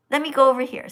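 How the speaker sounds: usual speaking voice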